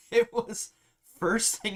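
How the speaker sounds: bursting into a laugh mid-sentence. Real, not acted. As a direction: laugh through the words. Laughs recalling the long day.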